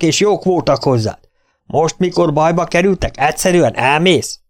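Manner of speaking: high, loud reproach; sharp challenging shout. A narrator's reading. Freckled Johnson scolds Tom for leaving, high angry reproach. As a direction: shame him for walking away. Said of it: "kiabálta éles, kihívó hangon".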